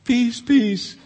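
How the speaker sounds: high, loud sing-song peace; mocking false prophet. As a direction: parody a false prophet's reassurance. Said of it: "They're called false prophets".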